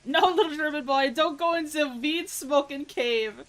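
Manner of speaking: high, loud pleading cry, drawn out at the end; hammed-up high-pitched warning; mock pleading. Streamer warning a little German boy, mock pleading.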